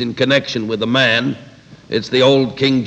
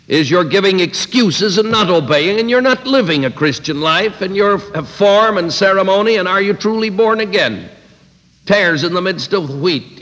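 rising rebuke; 6 semitones higher than usual. Retold or imitated. Lord rebukes Pharisees.

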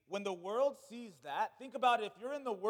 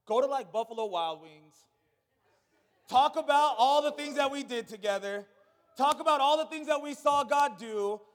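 loud, ringing sermon voice; full-force pulpit preaching; 7 dB louder than usual. Acted. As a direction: preach it out to the whole church.

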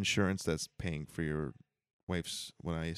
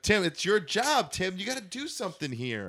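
high, slow exasperated plea; 10 semitones higher, 3 dB louder than usual. Acted. Boss pressing the guard.

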